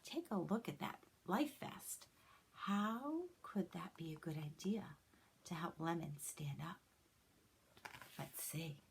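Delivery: soft unvoiced whisper to herself; whispered thinking. Holly thinking aloud in a hushed whisper. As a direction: whisper the idea to yourself. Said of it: "she thought to herself".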